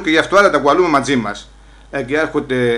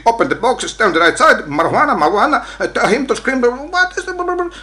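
mocking police; 8 semitones higher than usual. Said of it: "mocking police orders".

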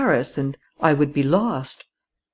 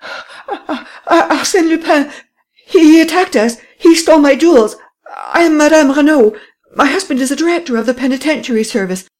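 high, loud, panting cries for help; breathless frantic outcry; 11 semitones higher, 6 dB louder than usual. Acted. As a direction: gasp it out in a frantic panic. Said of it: "Panting for breath, the lady exclaimed".

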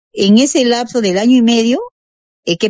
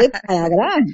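softer doubting repeat after a laugh; half incredulous; 5 dB quieter than usual. Retold or imitated. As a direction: question it lightly, half amused. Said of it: "Yo todavía media incrédula".

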